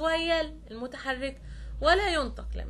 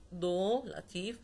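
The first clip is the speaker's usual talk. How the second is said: ordinary delivery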